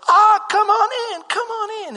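high New York deli lady greeting; New York accent. Retold or imitated. Deli lady welcoming visitors, high New York accent. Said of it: "in this New York accent".

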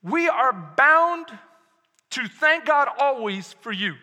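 bound drawn out slowly; slow emphatic reading. Shown as a demonstration. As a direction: read it slowly, weighting bound. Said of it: "read this to you".

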